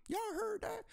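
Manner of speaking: disbelieving cry to the viewers; shocked. Real, not acted. Shocked at the timeline.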